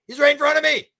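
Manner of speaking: high, loud, panicky insistence; frightened insistence. Retold or imitated. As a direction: insist in a panic that he's right there. Preacher insists the devil is there.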